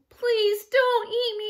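high, trembling little-goat plea; frightened squeak. A narrator's reading. Baby goat begs troll for mercy, squeaking. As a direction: squeak it out pleadingly. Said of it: "he squeaked".

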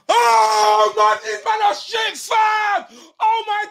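loud, exasperated fan outburst; outraged yell. Real, not acted. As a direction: yell in disgust at your own team. Fan reacting to conceded goal.

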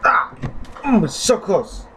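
high ah into groan; frustrated yelp. Real, not acted. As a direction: cry out at the near miss. Near success then failing.

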